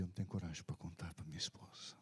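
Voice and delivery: hushed, ashamed whisper; whispered confession. Retold or imitated. Dying man whispers his shame to the pastor. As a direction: breathe it out like a secret.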